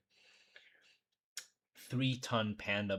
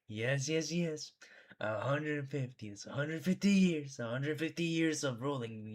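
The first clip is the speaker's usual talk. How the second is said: lightly voiced boastful ruler, pitched up; mock boastful leader; 5 semitones higher, 3 dB louder than usual. Streamer mimicking a politician bragging of long life, mock boastful. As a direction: put on a self-satisfied strongman's voice, a little raised.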